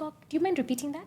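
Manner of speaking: high, fast, sweetly innocent; polite feigned innocence. Retold or imitated. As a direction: play dumb, sweetly. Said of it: "To ask people to be like".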